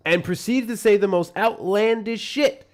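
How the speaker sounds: loud, high, slow Trump bluster; booming Trump rally voice; Trump impression. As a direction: do Trump ranting big at a rally. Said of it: "you do the Trump impression better than me".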